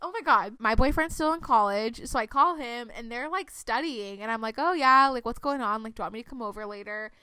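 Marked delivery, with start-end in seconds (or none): none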